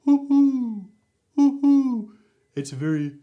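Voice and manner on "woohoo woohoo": high, loud owl hoot, a bit lower. Shown as a demonstration. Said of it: "or in lower range".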